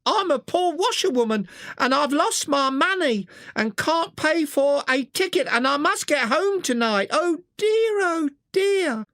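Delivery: high falsetto washerwoman cry; Toad crying out as washerwoman. A narrator's reading. Toad disguised, begging the engine driver, high falsetto cry. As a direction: Toad wails up high in a woman's voice. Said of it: "cried Toad".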